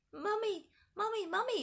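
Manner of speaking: put-on high-pitched frightened child's cry. A narrator's reading. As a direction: wail for mother like a small child. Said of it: "cried George from the darkness".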